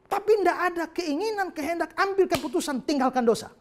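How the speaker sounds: loud, high, driving sermon voice; full-force preaching. Acted. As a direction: hammer the point home to the congregation.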